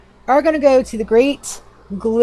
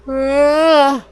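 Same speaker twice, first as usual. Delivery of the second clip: yawning; 6 semitones higher, 4 dB louder, much slower than usual. Real, not acted. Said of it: "That yawn came out of nowhere".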